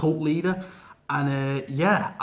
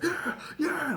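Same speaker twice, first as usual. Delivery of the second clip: mock Cena grunt; 13 semitones higher, 5 dB quieter than usual. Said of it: "Cena doing weights, like".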